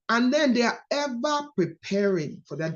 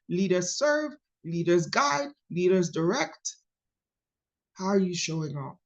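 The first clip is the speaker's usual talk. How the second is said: ordinary delivery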